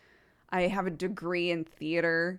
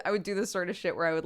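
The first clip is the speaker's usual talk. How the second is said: normal talking voice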